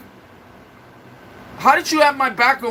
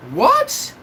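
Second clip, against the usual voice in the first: a sudden, much higher squeak of disbelief; shocked what. Real, not acted. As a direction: blurt it out in stunned disbelief. Shocked reaction to the comment.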